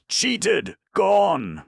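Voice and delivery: high, loud shout, leaning hard on the second syllable. A narrator's reading. Betrayed husband shouts his outrage to the doctor, loud and high. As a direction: spit it out, stressing the second syllable. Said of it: "he shouted".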